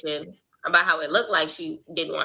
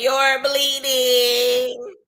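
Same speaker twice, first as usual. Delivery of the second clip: long drawn-out, high taunt; mocking Bahati; 5 semitones higher, 4 dB louder, much slower than usual. Reactor quoting Bahati twerking at her rival, drawn-out taunt. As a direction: stretch it way out, teasingly. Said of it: "talking about something".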